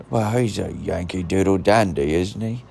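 terrible attempt at a British accent; badly faked English film-star accent; Michael Caine. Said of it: "Michael Caine".

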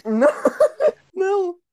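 squeaky giggling no's; trying not to laugh; stifled giggles. Real, not acted. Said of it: "segurando a risada, mordendo os lábios".

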